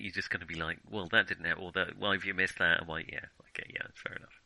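usual speaking voice

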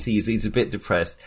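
usual speaking voice

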